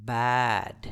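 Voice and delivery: slow model "baad", falling; long vowel. Shown as a demonstration. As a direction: stretch the open "a" in ବ୍ୟାଡ୍. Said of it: "ତାହେଲେ ଆମମାନଙ୍କର ଉଚ୍ଚାରଣ".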